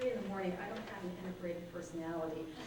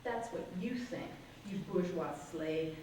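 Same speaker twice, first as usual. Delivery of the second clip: rising, louder and higher performance reading; poem reading; 3 semitones higher, 8 dB louder than usual. Acted. Poet performing her poem to the audience, building to loud climax. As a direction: build the poem to a rousing, shouted finish.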